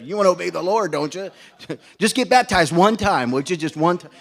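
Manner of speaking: playful pleading with a chuckle; coaxing Brother Scribe. Retold or imitated. Pastor coaxing a young man into baptism, playful pleading. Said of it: "Like Brother Scribe".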